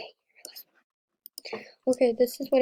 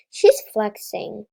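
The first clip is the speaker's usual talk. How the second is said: louder, slow, indignant accusation; 8 dB louder, slower than usual. Real, not acted. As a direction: call her out, drawn out. Indignant at player showing off.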